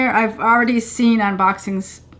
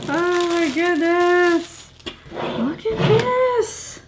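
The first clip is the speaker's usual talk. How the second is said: excited squeal; 8 semitones higher, 4 dB quieter, much slower than usual. Real, not acted. Squealing over the shirt.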